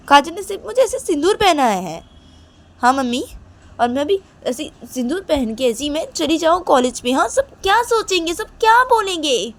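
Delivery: upset pleading. Acted. Reshma argues with mom about Kajal, high and distressed. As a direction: push hard, near tears. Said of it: "Reshma बोलती कि".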